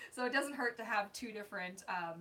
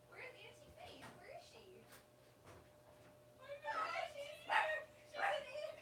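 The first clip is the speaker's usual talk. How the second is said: much higher, softer coo for the pet; 8 dB quieter, slower than usual. Real, not acted. Asking the dog where the friend is, soft high baby talk. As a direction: tease the dog gently, sing-song.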